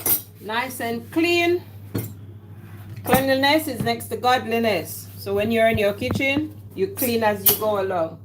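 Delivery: ordinary delivery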